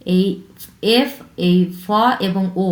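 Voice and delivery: normal talking voice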